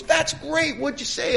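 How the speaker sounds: very high, excited mock reaction; eager sponsor. Sponsor asking what the speaker answered, eagerly excited.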